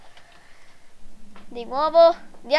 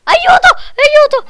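much higher, louder yelps; shrill mock cries; 9 semitones higher, 10 dB louder than usual. Gamer voicing the child in danger, shrill mock panic. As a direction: squeal for help like a cartoon victim.

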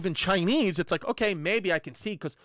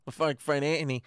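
ordinary talk

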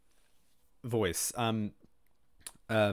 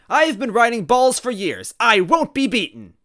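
loud, high character outburst; forceful raised-voice protest; crying out; 9 semitones higher, 11 dB louder, slower than usual. Acted. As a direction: cry it out in indignation. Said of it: "Wendy cried out".

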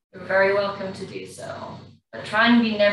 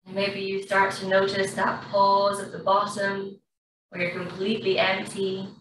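guided breathing. Acted. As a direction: ease the class through each breath. Yoga teacher guides breathing.

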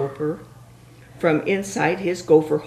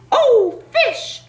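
high panicked call; frightened cry; 4 dB louder than usual. Acted. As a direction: cry out in alarm. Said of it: "the turkey cried".

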